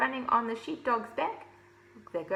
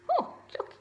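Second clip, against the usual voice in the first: very high breathy exclaim; excited. Real, not acted. Reader squealing to children at the page. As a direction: squeal at the picture.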